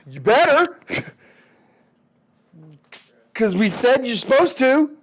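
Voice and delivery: high, squeaky mock threat; a bluff that rises high and thin. America bluffing Europe.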